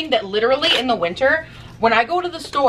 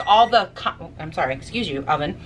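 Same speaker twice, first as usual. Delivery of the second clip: ordinary talk, nothing marked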